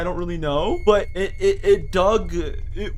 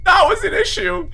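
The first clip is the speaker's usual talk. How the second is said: high, loud outburst of disbelief; shocked exclamation; 8 dB louder than usual. Real, not acted. Player retorting to his own earlier calm, loud and high. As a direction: blurt it out, still rattled. After mob killed him.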